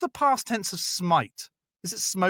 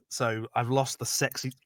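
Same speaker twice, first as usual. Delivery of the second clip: usual speaking voice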